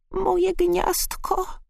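tiny, very high slow wail; moaning skylark. A narrator's reading. Skylark finding its nest gone, high moan. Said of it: "jęknął skowronek".

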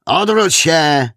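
loud, high, drawn-out command; called-out order. A narrator's reading. Junior inspector orders the suspect about, called-out order. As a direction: call the order out slowly and high. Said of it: "zawołał młodszy inspektor".

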